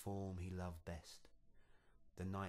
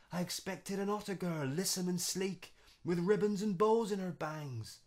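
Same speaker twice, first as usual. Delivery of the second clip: high, loud, fast aggrieved wail; shocked outburst; 11 semitones higher, 12 dB louder than usual. Acted. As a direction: rant in dismayed shock. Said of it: "he cried".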